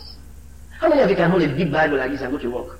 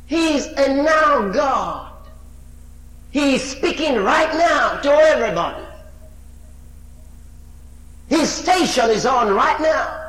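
rising preacher's cry; full-force preaching; 7 semitones higher than usual. Acted. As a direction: proclaim it at full power. Preaching builds to a shout.

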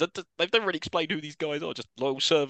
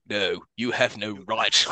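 slow, low solemn line; solemn objection; 6 semitones lower, much slower than usual. Retold or imitated. Doctor objecting to the mercy kill, slow and grave. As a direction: drag it out gravely. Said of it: "He just goes".

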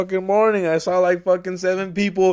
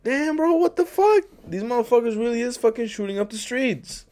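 much higher, startled disbelief; shocked outburst; 9 semitones higher than usual. Retold or imitated. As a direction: squawk it in alarmed disbelief. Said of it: "And I'm like".

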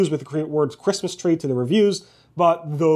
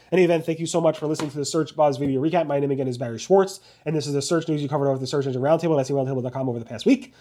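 quick, run-on sign-off; talking fast; faster than usual. Real, not acted. Host hurriedly wrapping up a weekly search news recap, talking fast. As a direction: rattle through the outro at speed. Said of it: "Sorry for talking fast".

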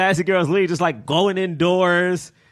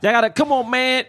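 acted urging; 8 semitones higher than usual. Voicing Martin urging friends to hug.

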